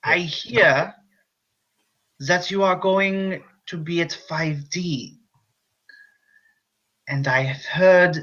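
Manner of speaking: a hammy foreign doctor introducing himself; muddled German accent; slipping French-German accent. Acted. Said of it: "making French accent with German accent".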